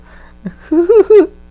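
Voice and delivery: high, loud cartoon-villain cackle; imitated maniac laugh; Mark Hamill Joker laugh. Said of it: "I love Mark Hamill's Joker".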